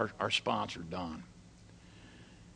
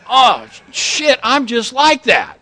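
startled realization; 11 semitones higher, 13 dB louder, slower than usual. Retold or imitated. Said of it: "And I thought".

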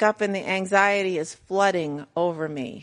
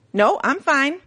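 mock stubborn mother; 7 semitones higher, 4 dB louder, slower than usual. Imitating stubborn injured mother.

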